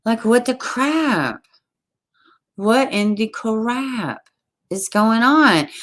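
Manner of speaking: slow, drawn-out disbelief; exasperated. Real, not acted. Host reacting to a disturbing story, exasperated. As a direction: drag out every word, incredulous.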